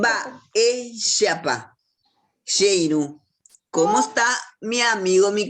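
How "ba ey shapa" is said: "Ba-, ey, shapa" in separate pieces, "ey" stressed; teacher's broken-up "Ba-, ey, shapa", weight on "ey". Shown as a demonstration. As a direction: pause after "Ba-", then press on "ey". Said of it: "E cargamos más la voz".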